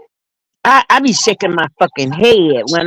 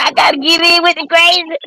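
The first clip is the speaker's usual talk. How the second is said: laughing; 6 semitones higher than usual. Retold or imitated. Laughing retelling own quote.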